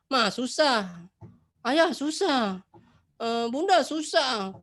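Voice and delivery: put-on high, drawn-out childish whine; whiny child. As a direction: whine to every grown-up that it's too hard. Anak manja mengeluh tugas susah. (rengekan anak manja yang mengeluh susah)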